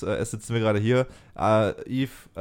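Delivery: normal talking voice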